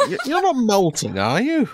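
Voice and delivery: high, loud wizard voice; suspicious wizard. Acted. Wizard to fox customer about the bed, incredulous.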